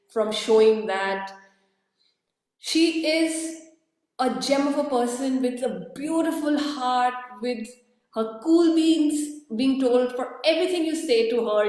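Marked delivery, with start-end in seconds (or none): none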